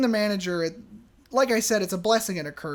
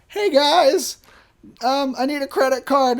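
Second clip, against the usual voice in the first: higher and louder over the yelling; raised to be heard; 6 semitones higher, 7 dB louder than usual. Retold or imitated. Clerk interrupts the arguing couple for a card, loud. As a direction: call out nervously over a fight. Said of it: "I'm like".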